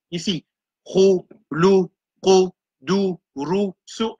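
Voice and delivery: each syllable spelled out clearly; modelled sounds. Shown as a demonstration. Teacher sounding out Arabic letters for the class, clipped and clear. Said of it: "c'est le son".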